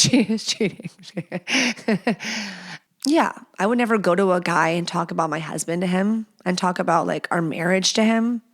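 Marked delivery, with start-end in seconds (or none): 0.0-2.2 trails into soft laughter; laughing. Real, not acted. Host cracking up at her own joke, quietly laughing. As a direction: laugh the line away quietly.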